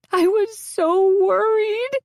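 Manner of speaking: high, slow, loud tearful cry. A narrator's reading. Sobbing friend clinging to the patient, tearfully over the top. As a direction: sob it out, overcome. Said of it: "says through tears and trembling shoulders".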